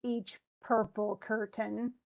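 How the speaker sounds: slow, "purple" stressed on pur. Shown as a demonstration. Teacher showing an alternate stress reading. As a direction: lean on the beat of purple. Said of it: "you could say".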